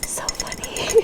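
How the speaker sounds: soft whisper. Acted.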